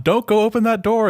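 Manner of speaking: high-pitched pretend warning yell; mock movie-theater yell. Moviegoer warning the character not to open the door, mock yelling. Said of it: "It's like yelling in the movie theater".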